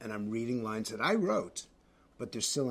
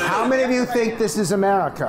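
raised, high, forceful question; loud challenge; 7 semitones higher, 10 dB louder than usual. Real, not acted. Host challenging a squabbling panel, loudly. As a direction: throw the question over the din.

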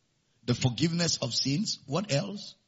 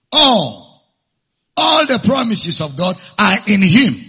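preaching at full force; 6 semitones higher, 12 dB louder than usual. Acted. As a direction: thunder it from the pulpit so the back rows answer. Loud preaching to congregation.

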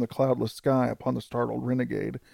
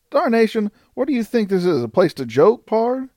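high-pitched exasperated outlaw; incredulous frontier renegade, voice pitched way up; indignant disbelief; 9 semitones higher, 3 dB louder than usual. A narrator's reading. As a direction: protest high, rising sharply at the end. Renegade protests at being held up.

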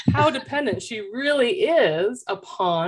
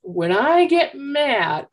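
put-on higher, slow, deliberate little-girl voice; child's voice; 6 semitones higher, much slower than usual. Mother imitating her daughter's slow announcement to her sister. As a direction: mimic the daughter spelling it out slowly. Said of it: "looked over at her sister and says".

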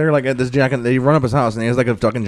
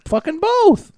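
high-pitched exasperated quote; mock exasperation, pitch leaping up; exasperated retort; slower than usual. Acting out the golf lesson from the show, high exasperated retort.

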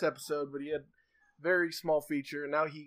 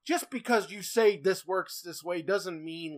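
normal talking voice

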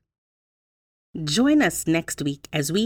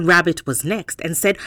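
ordinary delivery

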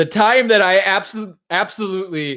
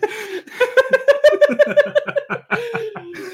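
long high burst of real laughter; delighted cackling laugh. Real, not acted. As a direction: crack up at the line. Laughs at the hentai quote.

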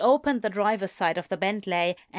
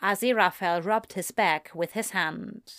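plain reading voice

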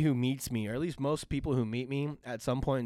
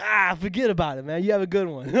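loud, higher New York Italian send-off; New York Italian-ish accent; 4 semitones higher, 6 dB louder than usual. Retold or imitated. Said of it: "New York Italian-ish accent".

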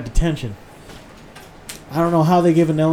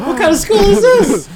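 much higher, laughing, slowed; laughing disbelief; 7 dB louder, slower than usual. Real, not acted. Friend mocks a strict school, laughing high and loud. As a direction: squeal it out in disbelief.

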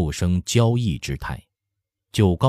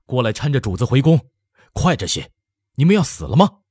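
urgent, raised, higher voice; flustered barked order; 6 semitones higher, 4 dB louder, faster than usual. A narrator's reading. Minister summoning officials to help the emperor, urgent shouting. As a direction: shout at them to hurry up. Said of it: "张忙之下喝叫几个管工的吏员".

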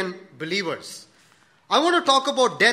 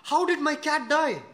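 much higher, shocked and upset; distraught shock; 8 semitones higher than usual. Retold or imitated. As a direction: cry out in disbelief over the cat. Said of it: "he was so distraught, he was so sad".

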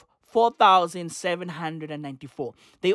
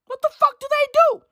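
outraged; faster than usual. Real, not acted. Ranting at politicians.

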